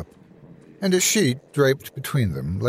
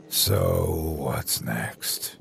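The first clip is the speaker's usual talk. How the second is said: slow, low, worn-out voice; all the fight drained out; tired; 5 semitones lower, 4 dB quieter, much slower than usual. A narrator's reading. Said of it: "The bite from his words was gone".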